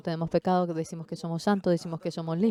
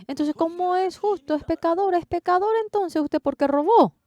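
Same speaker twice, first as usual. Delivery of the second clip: much higher, pressing questions; doubting pastors; 12 semitones higher, 4 dB louder than usual. Retold or imitated. Pastors demand why the preacher stole, high and pressing. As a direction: press him like a puzzled pastor.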